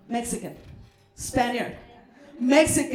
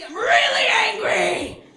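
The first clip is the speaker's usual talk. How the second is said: higher, louder mock fury on really; mock angry; 7 semitones higher, 4 dB louder than usual. Comic showing her Mexican anger, mock furious. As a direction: play-act rage with a yell. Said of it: "when I'm angry".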